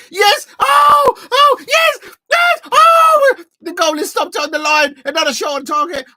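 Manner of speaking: very high, very loud yells of joy and anguish; screaming with excitement. Real, not acted. Fan watching a goal-line clearance, screaming high and loud. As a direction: erupt as the ball nearly goes in.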